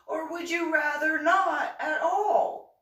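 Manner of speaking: high, loud, slow laughing disbelief; squealing with disbelieving laughter; incredulous laughing squeal. Real, not acted. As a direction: laugh the absurd alternative out. Mocking rhetorical question, laughing.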